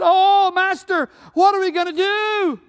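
high, loud, slow frightened plea; terrified servant. Acted. Servant sees the enemy army and begs Elisha, high frightened cry. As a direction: cry out to your master in panic.

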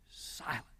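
whispered, barely audible; very soft, stunned whisper; hushed awe. Retold or imitated. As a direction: let the word vanish into a hush. Acting out dead silence.

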